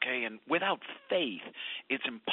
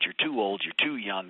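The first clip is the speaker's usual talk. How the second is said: ordinary delivery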